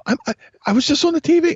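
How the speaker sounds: high, loud, fast stammer; excited blurt. Retold or imitated. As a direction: gush it out breathlessly fast. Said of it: "And I go".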